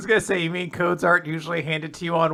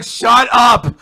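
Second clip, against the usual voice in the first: loud, higher shut up; mock shout; 6 semitones higher, 10 dB louder than usual. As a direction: bark it to cut her off. Mock yelling at a character.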